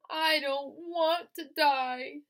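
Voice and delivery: high, loud, slow wailing scream. Acted. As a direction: scream it throwing yourself down. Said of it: "screamed Wilbur".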